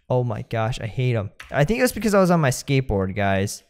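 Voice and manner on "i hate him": ordinary talk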